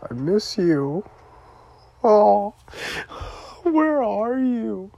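slow, high tearful wail; aching cry for someone missing; real crying. Real, not acted. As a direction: draw the words out through tears. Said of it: "Why am I crying".

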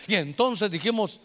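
ordinary talk, nothing marked

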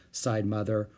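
ordinary delivery

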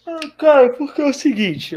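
talking through a yawn. Real, not acted. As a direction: stretch the words with a yawn. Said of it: "ó, eu bocejei mesmo".

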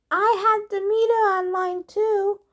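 high-pitched lady snake calling; female snake voice. A narrator's reading. Martha the snake interrupting from the pocket, high voice. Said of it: "Martha the snake muffled voice".

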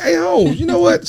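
mock reply. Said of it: "You be like".

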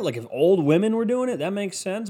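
ordinary talk

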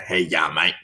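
lightly gruff country-town bloke; a touch of low, gruff mateyness. Retold or imitated. Country-town coworker greeting a mate, lightly gruff. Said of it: "kinda gruff".

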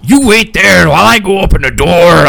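high-pitched, put-on character voice; mimicked character voice. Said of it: "that I can mimic".

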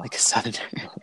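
amused whispered echo. Real, not acted. Repeating the friend's joke, in an amused whisper. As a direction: breathe it under a held-back laugh. Echoing the joke under his breath.

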